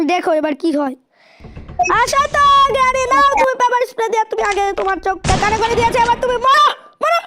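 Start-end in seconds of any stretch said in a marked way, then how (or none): 2.0-7.2 gleeful taunt. Real, not acted. Taunting granny while attacking.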